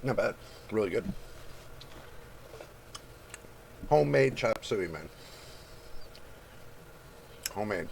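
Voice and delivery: talking while chewing; words round a bite of food; mouth full. Real, not acted. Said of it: "now I get to eat my lunch with y'all".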